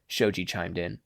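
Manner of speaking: usual speaking voice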